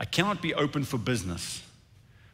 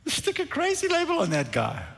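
high-pitched mock dismissal of the giant; David; 3 dB louder than usual. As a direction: brush the threat off in a high voice. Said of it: "David looked at him and said".

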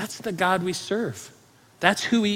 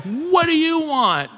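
high, drawn-out angry demand; 11 semitones higher, 4 dB louder, slower than usual. Retold or imitated. Scowling homeowner to repairmen at his front door, angry. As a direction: imitate an angry man, pitched way up. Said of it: "just looked scowling, angry".

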